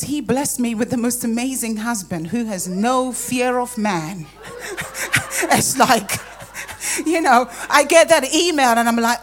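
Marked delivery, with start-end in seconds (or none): 4.4-5.5 high-pitched giggle; quick amused burst of laughter. Real, not acted. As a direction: let out a short, high laugh. Laughs about her fearless husband.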